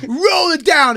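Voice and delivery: high, loud angry command; cop barking. Retold or imitated. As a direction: bark the order. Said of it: "And he goes".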